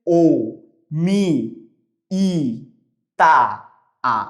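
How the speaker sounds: ও, মি, ই, তা, আ each said alone with pauses; vowels split out. Shown as a demonstration. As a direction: sound out every vowel separately. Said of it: "এখানে কি হচ্ছে". (প্রতিটি স্বর আলাদা করে বলা)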